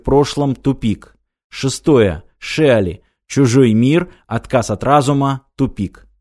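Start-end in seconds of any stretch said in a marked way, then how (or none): none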